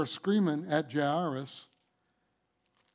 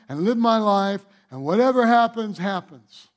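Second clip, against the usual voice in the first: loud, hopeless giving-up voice; voicing a defeated believer; 3 semitones higher, 6 dB louder than usual. Preacher acting out a believer who gives up, loud and hopeless. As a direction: throw up your hands and quit out loud.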